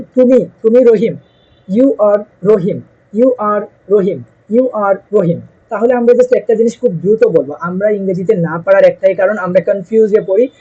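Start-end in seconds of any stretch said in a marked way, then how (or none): 0.0-5.4 slow, clear drill, each repeat paced out; slow drill model. Shown as a demonstration. Teacher to students, drilling slowly so they can repeat. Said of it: "এখন আপনি বলতে চাচ্ছেন".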